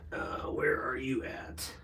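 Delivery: soft whispered question. Real, not acted. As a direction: whisper it to yourself. Searching quietly for sticker.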